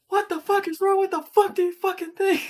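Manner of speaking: very high, loud re-enacted rage; furious squeal, acted out; throwing a tantrum. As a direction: explode in a hammed-up high-pitched fit. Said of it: "I'm just throwing a tantrum".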